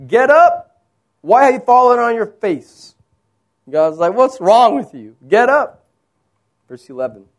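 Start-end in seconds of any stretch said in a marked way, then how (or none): 0.1-2.9 loud, high exasperated command; acted rebuke. God rebukes Joshua, acted. 4.1-5.8 raised, louder mock scolding; exasperated rebuke. Paraphrase of God's rebuke.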